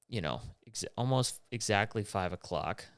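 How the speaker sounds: ordinary talk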